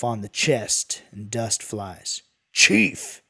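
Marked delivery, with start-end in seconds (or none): 2.5-3.2 loud, high one-word bark; yelled. A narrator's reading. Dingo slaps his chest at the kid, yelling. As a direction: yell it sharply, higher and louder. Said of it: "he yells".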